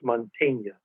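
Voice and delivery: Mantegna said the Italian way: man-TEH-nya, with an open "ah" and a "ny" sound; proper Italian pronunciation. Shown as a demonstration. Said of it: "the proper Italian pronunciation really would be Mantegna".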